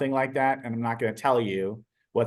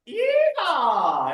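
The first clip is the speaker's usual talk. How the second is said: high cowboy whoop, much higher; yeehaw call; 12 semitones higher, 4 dB louder than usual. Retold or imitated. Kid greeting the teacher with a high yeehaw whoop. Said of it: "he goes".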